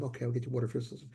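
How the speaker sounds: fast, soft, offhand reply; quick soft promise. Retold or imitated. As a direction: toss off the promise quickly under your breath.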